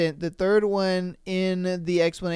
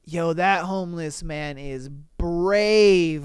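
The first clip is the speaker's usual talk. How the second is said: ordinary talk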